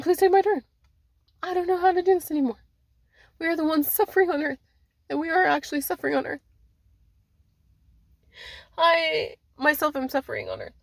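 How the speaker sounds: high, distressed pleading; acted tearful outcry, pitched well up; crying plea. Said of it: "They're actually crying".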